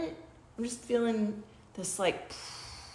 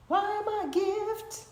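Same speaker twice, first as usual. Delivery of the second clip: much higher, lilting read; high sing-song; 13 semitones higher than usual. Shown as a demonstration. Host reading the question card, high lilt. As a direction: lift Why am I a gift way up playfully.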